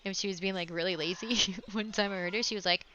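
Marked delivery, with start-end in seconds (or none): none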